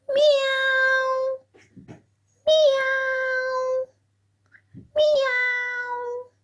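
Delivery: three high, insistent meows; a cat pestering for attention; playful meows. Acted. The cat wants the busy owner to play, high meows.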